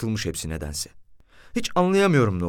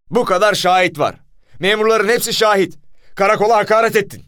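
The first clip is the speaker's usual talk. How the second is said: loud, high angry shouting; commissioner's furious insistence; thunderous accusation; 10 semitones higher, 7 dB louder than usual. A narrator's reading. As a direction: hammer the charge home, shouting. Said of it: "Komiserin gök gürültüsü gibi sesiyle".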